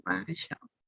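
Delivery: ordinary delivery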